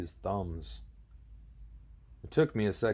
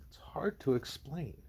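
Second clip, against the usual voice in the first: whispering; 5 semitones higher, 5 dB quieter, slower than usual. A narrator's reading. Julie, hesitant, struggling to explain.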